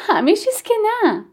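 high, lilting amused denial; laughing reply. A narrator's reading. Shopkeeper correcting her friend after laughing, high and amused. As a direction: answer lightly on the tail of a laugh. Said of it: "در جواب خندهٔ من".

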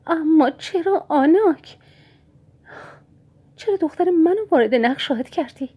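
mother asks why her daughter was dragged in, voice trembling. Acted. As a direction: let the voice quiver as she begs why. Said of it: "با صدایی لرزان گفت".

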